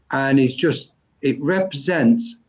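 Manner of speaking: ordinary talk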